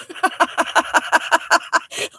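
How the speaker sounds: long, high run of laughter; real laugh. Real, not acted. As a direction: crack up at your own joke. Laughing at own lungs joke.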